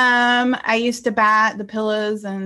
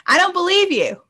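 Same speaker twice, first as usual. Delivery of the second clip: high-pitched, amused disbelief; playful, incredulous rebuttal; teasing disbelief; 8 semitones higher than usual. Acted. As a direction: call it out high, on the edge of laughing. Repeats her response, laughing.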